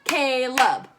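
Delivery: Caleb in two loud beats; clapped-out syllables. Shown as a demonstration. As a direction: split Caleb as if clapping each half. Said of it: "let's do it clapping".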